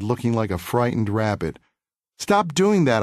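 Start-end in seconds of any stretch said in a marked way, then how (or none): 2.2-3.0 high, pushed stop doing that; boy grabbing his tormentor, mild anger; firm demand. Retold or imitated. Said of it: "I said, grasping him".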